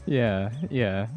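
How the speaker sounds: ordinary talk, nothing marked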